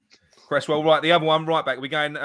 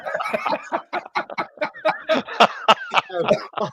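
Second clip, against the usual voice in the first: long unvoiced wheezing laugh; long breathy laugh; 10 semitones higher than usual. Real, not acted. Laughing at frozen friend.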